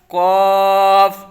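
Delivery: heavy rounded قاف, loud and stretched; full, thick Qaaf. Shown as a demonstration. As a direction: hold a full-mouthed قاف. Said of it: "یعنی موٹا کر کے پڑھیں گے".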